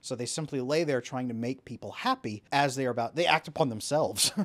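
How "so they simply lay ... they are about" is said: ordinary talk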